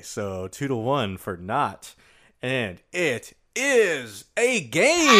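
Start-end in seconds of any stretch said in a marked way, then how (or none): 2.4-5.2 slow, drawn-out announcer verdict; dramatic game show reveal. Acted. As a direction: stretch it out like a big reveal.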